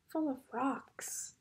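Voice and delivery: hushed suspense whisper. Real, not acted. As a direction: lean in and whisper. Suspenseful storytelling aside.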